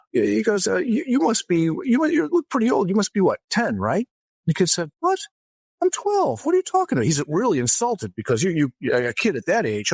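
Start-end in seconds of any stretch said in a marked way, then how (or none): 0.8-4.1 high, teasing put-down; lightly voiced priest ribbing a kid; a hint of a sly tease; mock teasing. Priest teasing kid about age. 5.0-7.0 put-on much higher, outraged kid voice; squeaky hammed-up kid protest; indignant kid.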